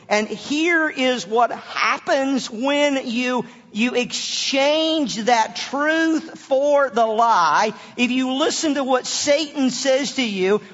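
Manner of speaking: loud, high-pitched sermon delivery; building to full-voiced pulpit intensity; impassioned preaching. Real, not acted. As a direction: preach it forcefully, stretching the key words.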